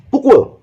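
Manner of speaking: "Pukul" high, quick, falling; short falling intonation. Shown as a demonstration. Teacher showing one meaning, "Pukul" short and falling. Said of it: "tapi intonasi yang berbeda".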